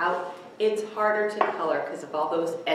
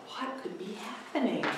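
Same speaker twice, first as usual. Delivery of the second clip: plain reading voice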